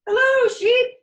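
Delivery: very high, loud duck hello; cheery duck greeting. Acted. Duck waving to Sheep from the bike, very high. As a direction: squeal out the greeting like a proud duck.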